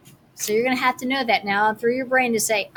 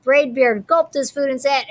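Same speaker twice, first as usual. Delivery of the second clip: ordinary delivery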